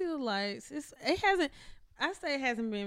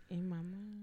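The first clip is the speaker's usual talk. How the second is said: really low; 4 semitones lower, 11 dB quieter, slower than usual. Shown as a demonstration. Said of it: "It was supposed to be a really low".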